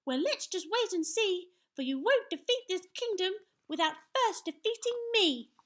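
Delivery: put-on high, loud boyish challenge; little knight crying out. A narrator's reading. Young knight challenges the giant, crying out. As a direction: fire back high and defiant. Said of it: "cried Sir Scallywag".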